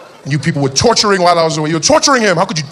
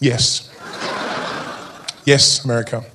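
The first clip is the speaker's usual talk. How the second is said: deadpan; 6 semitones lower, 5 dB quieter, slower than usual. Pretend. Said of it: "I look at her and I'm like".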